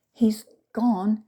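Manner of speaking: shaky frightened whisper; whispered, stammering in fright; stammered. A narrator's reading. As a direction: breathe it out, scared stiff. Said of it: "stammered Mother".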